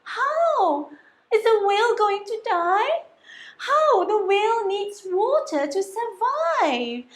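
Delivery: high, worried questions; fretting for the dying whale; anxious high voice; panicked snail. Acted. Snail panics over beached whale.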